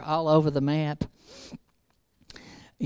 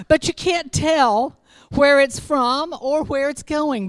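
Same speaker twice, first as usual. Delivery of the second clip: loud, slow preached scripture; full-force preacher cadence; preaching; 8 semitones higher, 5 dB louder, slower than usual. Acted. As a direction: proclaim it to the room.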